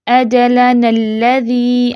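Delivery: "اجلنا الذی" recited very slowly on a level tone, the two words joined; Quranic example reading. Shown as a demonstration. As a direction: recite "اجلنا الذی" measured, "نا" short into the doubled lam. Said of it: "دوسری مثال دیکھ لیتے ہیں". ("اجلنا الذی" ٹھہر ٹھہر کر ملا کر تلاوت)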